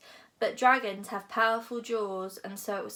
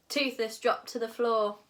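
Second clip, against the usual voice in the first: ordinary delivery, nothing marked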